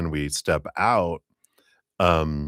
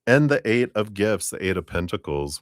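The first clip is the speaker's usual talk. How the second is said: ordinary talk, nothing marked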